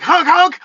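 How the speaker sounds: high honk honk; high-pitched mock horn; imitated honking. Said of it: "he'd be like".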